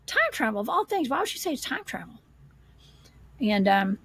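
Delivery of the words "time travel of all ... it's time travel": retold puzzlement, pitched high; baffled incredulity. Hiker puzzling over why a woman yelled that, incredulous. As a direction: question it high, in disbelief.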